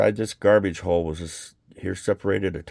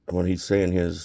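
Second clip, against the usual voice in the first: usual speaking voice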